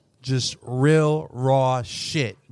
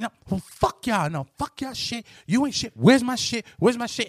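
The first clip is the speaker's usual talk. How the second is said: pitched-up mock angry rant; retold angry outburst; 9 semitones higher than usual. Recounting a TV character's breakdown, higher mock angry rant. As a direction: replay her outburst, much higher. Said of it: "she's like, retold character".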